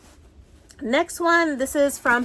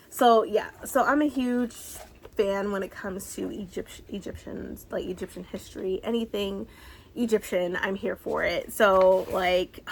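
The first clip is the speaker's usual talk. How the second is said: ordinary talk, nothing marked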